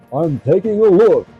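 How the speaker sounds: high, loud mock Italian accent. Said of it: "That didn't sound like an Italian".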